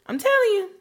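high insistent; emphatic. Real, not acted. Emphatic advice.

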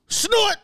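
a quick imitated sniff up the nose, high; mock sharp snort. Driver imitating the passenger snorting coke, quick mock snort. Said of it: "I see her quickly go".

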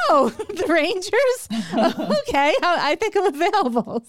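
playfully re-enacted change of heart; suddenly interested. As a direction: act suddenly very available, with a laugh. Acts sudden interest at the Rangers.

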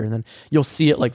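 ordinary talk, nothing marked

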